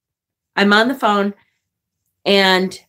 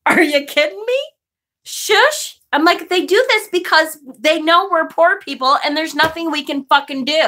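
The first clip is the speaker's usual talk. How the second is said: raised, higher-pitched indignant rant; loud outrage; 8 semitones higher than usual. Retold or imitated. Venting at the counter clerk, loud and outraged. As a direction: let the outrage ride high and loud. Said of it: "And I'm this loud".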